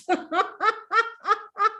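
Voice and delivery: high, rolling laugh that fades; high staccato laughter, tailing off; long laugh. Real, not acted. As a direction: laugh until out of air. Laughing after the saying.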